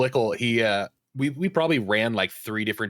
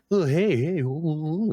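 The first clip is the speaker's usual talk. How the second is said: lightly mimicked goofy guy, higher wavering oohs; goofy greeting; 4 semitones higher than usual. Mimicking a random player's wiggly hello, lightly goofy. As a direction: imitate a silly, singsong hello.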